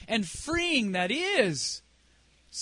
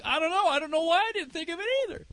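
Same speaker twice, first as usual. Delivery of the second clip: high, hurried protest; rising, helpless shrug in the voice; mock bafflement; 6 semitones higher, faster than usual. Preacher answering the amazed elders, high-pitched bafflement. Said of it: "I'm like".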